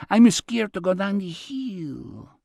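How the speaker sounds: high, slow put-on Jose Jimenez Spanish accent. Robert imitating Jose Jimenez to Anne, slow high Spanish accent. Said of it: "with his accent".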